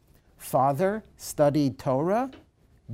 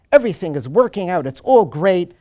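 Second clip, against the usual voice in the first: loud, quick burst of triumph; jubilant, much louder, rushing; triumphant exclaim; 4 semitones higher, 10 dB louder than usual. Retold or imitated. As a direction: announce the win, loud and fast. Father's triumphant words quoted.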